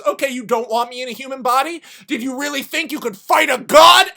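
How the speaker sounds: acted wrathful god; booming god's taunt. War god taunts in fight.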